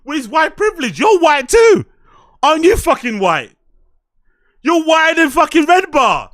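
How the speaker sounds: loud, disbelieving outburst; yelling in disbelief at a co-host; incredulous shouting. Real, not acted. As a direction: shout it back at him in disbelief.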